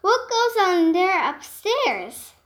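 puzzled shout to the top of his head; calling out. Acted. Moose calls up to his riders, loud and puzzled. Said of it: "he called out".

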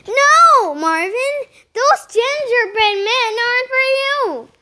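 loud, high-pitched telling-off; urgent scolding. A narrator's reading. Nick stopping Marvin at the neighbour's door, urgent scolding. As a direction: call the pet off firmly.

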